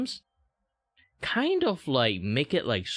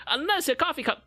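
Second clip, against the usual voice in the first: mock teacher. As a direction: keep explaining in the hammed-up teacher voice. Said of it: "let me teach you English".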